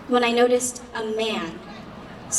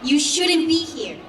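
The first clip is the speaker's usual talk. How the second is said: high, louder hostile outburst; agitated shout; 6 semitones higher, 5 dB louder than usual. A narrator's reading. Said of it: "He became agitated and shouted".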